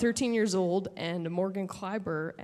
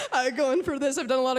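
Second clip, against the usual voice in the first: laughing; 5 semitones higher, faster than usual. Real, not acted. Laughing about scaring her family.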